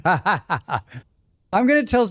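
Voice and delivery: laughing through the words, drawn out; can't hold back the giggles; real laughter breaking the phrase. Real, not acted. Laughing while reading chat.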